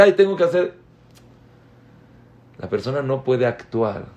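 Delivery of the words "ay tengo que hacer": distracted dismissive parent. Parent brushing off child.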